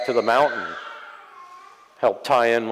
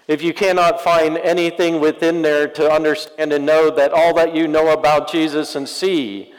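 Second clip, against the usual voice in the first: louder, raised preaching push; fervent sermon call to the congregation; 6 semitones higher, 5 dB louder than usual. Acted. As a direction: build the appeal to the pews. Preacher drives point to congregation.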